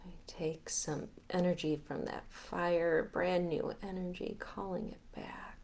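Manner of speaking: guided meditation. Acted. Leader guiding listeners to release and get grounded, guided meditation. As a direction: talk them gently through letting go, unhurried.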